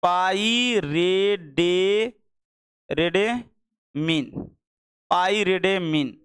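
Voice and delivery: teacher slowly spelling the word out for notes; slow syllable dictation. Shown as a demonstration. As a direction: dictate पाइरेडेमिन piece by piece, very slowly. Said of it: "और एक मिला पाइराइडिन".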